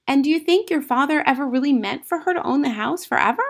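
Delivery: put-on high, insistent child's voice; probing young Matilda. A narrator's reading. Matilda questioning about the aunt, high inquisitive voice. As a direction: probe like an inquisitive little girl.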